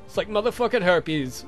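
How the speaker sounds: usual speaking voice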